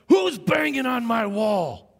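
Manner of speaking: storming-in angry shout, drawn out; mad teacher. Retold or imitated. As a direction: imitate a furious teacher bursting into a classroom. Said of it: "he got really mad".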